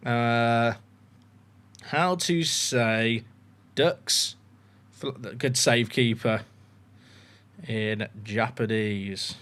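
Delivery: slow, low and soft; absent-minded typing voice. Real, not acted. Streamer reading out his phone search as he types, absent-minded. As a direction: say it word by word as you type.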